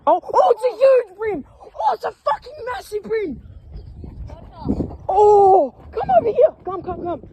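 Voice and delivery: high, loud, thrilled yelling; excited shout. Real, not acted. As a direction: whoop at the sight of a huge fish. Sees the huge fish.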